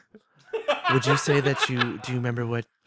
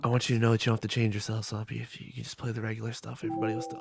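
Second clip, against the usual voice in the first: ordinary talk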